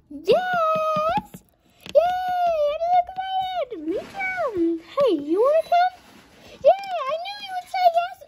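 high squealing yay; excited cheering. Pretend. Toy friends cheering over the fun plan, squealing high. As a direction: cheer like an overjoyed little kid.

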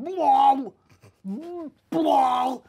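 loud imitated vomiting noise; mock retching. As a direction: make the sound of throwing up.

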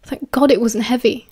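ordinary delivery

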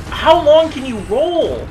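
loud, slow, high-pitched complaint; high exasperated yell. Real, not acted. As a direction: yell it slowly at the screen. Yelling at a rolling enemy.

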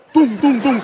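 spoken knock-knock sound effect; imitated door knocking. Someone knocks on the door at mealtime, imitated knocking. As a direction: voice three short knocks.

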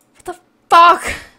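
loud, drawn-out, slow shout; frustrated shout. Real, not acted. Streamer screams at the broken game, loud and slow. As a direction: let it rip, slow and loud. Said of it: "heel erg aan het schreeuwen".

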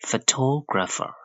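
slow phoTOgrapher, first syllable reduced to "fuh"; stress on TO. Shown as a demonstration. Teacher demonstrates for learners, stressed TO in Photographer. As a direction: raise TO high in Photographer. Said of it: "pha လို့ပဲထွက်တော့တယ်".

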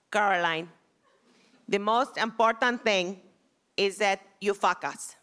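put-on teacher lecturing her on Kabbalah in an Israeli accent. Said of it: "crazy Israeli accent".

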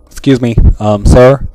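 loud, insistent call across the stage; calling out loudly. Acted. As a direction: project it so he hears you.

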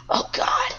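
high panicked yells; panicked scream. Real, not acted. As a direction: shriek it in panic. Game chaos, panic.

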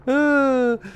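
high, loud unhinged cackle; crazy man laugh. Real, not acted. Frustrated gamer cracking up, crazy man cackle. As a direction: laugh like you've lost your mind. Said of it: "This is the laugh of a crazy man".

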